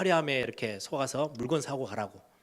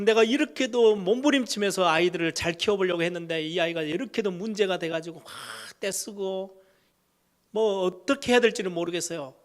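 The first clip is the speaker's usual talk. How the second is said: higher, louder pleading of a worn-out mother; desperate parent; 5 semitones higher, 4 dB louder than usual. Retold or imitated. A mother complaining to the pastor, higher and louder, pleading. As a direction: plead like a parent at the end of her rope. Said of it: "그렇게 찾아오는 부모들이 있죠".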